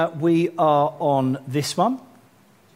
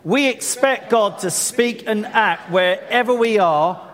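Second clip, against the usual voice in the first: leading declarations; 6 semitones higher, 3 dB louder than usual. Acted. Pastor calls the church to declare together, rallying. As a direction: lift the room to stand and declare.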